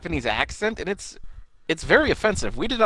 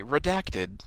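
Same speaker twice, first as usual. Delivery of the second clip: robot woman voice; 7 dB quieter than usual. Pretend. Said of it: "our robot woman voice to say".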